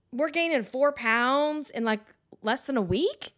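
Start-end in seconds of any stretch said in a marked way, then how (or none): none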